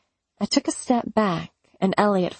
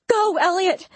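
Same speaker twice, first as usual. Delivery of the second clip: high, loud urgent plea; frantic, shooing him away; panicked; 12 semitones higher, 3 dB louder than usual. Acted.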